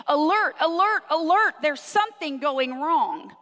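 urgent loud warning siren voice; alarm call. Acted. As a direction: call it out like a blaring cockpit alarm. Gauges in a plane sounding alarm.

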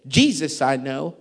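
loud, slow challenge, building in force; evil spirit. Acted. Evil spirit answering the sons of Sceva, loud challenge. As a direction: build to a drawn-out, booming "who are you". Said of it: "the evil spirit answered and said".

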